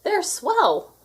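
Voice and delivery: high, bright chime in unison; chimed together. A narrator's reading. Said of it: "we chimed".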